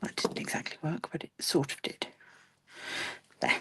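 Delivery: whispered grumble to herself; muttering to herself. Real, not acted. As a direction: mutter it under your breath. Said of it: "I'm just muttering to myself now".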